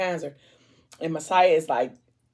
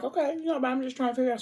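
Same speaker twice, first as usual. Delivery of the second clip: pitched way up, earnest; voiced friend, higher; 7 semitones higher, 4 dB quieter, faster than usual. Friend pushing back, voice pitched up. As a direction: raise the voice high to plead.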